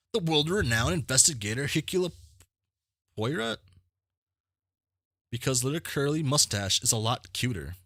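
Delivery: high, excited fawning voice; high-pitched starstruck host; gushing game character. Acted. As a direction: gush over a famous guest in a high voice.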